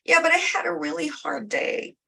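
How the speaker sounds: put-on high whiny teen complaining; pouty teenager dodging cleaning her room, high and whiny. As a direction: whine like a sulky teenager who wants out of chores. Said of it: "you're telling your teenager".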